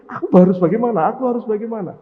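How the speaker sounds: fast, loud flustered plea; panicking, not knowing what to do; confused angel. Acted. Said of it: "malaikat Jibril bingung".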